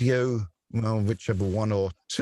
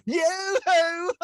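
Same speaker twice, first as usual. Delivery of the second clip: yoo-hoo cry. Retold or imitated. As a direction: hoot it out high and long. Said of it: "of the counter and go".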